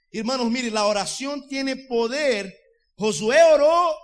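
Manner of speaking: loud, high shouted preaching; preaching at full force. Acted. Pastor proclaiming to the congregation that prayer has power, shouting. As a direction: cry it out to the congregation.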